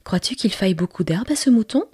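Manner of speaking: put-on a delighted small boy, lifted a little; little prince's voice.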